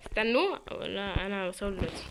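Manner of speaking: ordinary talk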